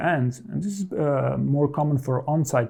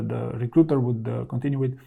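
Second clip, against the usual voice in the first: normal talking voice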